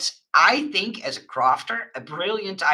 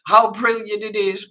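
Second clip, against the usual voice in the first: frustrated pleading; 5 semitones higher, 5 dB louder than usual. Retold or imitated. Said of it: "get a little bit frustrated".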